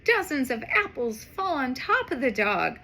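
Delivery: plain reading voice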